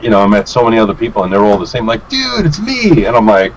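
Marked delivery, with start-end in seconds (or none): 2.1-3.1 high-pitched, put-on incredulous plea; mock exclamation of someone unrecognized; imitated eager fan. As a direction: insist like a fan expecting to be known. Fans expecting recognition, retold.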